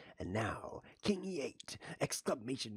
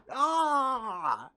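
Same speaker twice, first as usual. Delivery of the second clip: high loud yelp, then laughing; startled shriek, laughing after; shocked yelp then laugh; 7 dB louder than usual. Real, not acted. Loses in three moves.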